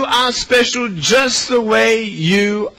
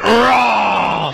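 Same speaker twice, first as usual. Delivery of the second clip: drawn-out mock roar; hammed-up growly roar; playful monster roar. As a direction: roar at a child like a pretend monster. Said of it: "and I went".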